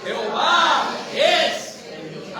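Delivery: loud, high, slow congregational cry; with conviction. Shown as a demonstration. Preacher leads the brothers repeating it, loud convinced proclamation. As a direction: declare it with full conviction, drawn out. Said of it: "Digámoslo así con convicción porque es real".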